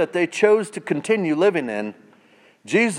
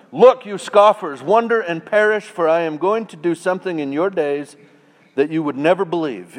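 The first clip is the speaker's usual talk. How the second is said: higher, louder prophetic call; prophetic proclamation; 4 semitones higher, 5 dB louder than usual. Acted. Prophet calling out the scoffers, loud proclamation. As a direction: thunder it at the scoffers.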